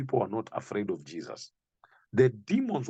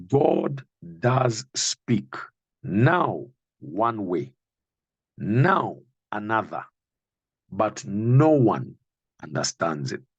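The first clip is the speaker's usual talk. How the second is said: slow, weighty preaching of the verse; 2 semitones lower, 4 dB louder, slower than usual. Acted. Preacher voicing Elihu to Job, slow preaching. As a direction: let each phrase land slowly.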